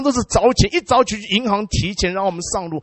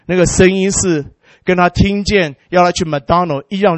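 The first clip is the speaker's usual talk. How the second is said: ordinary talk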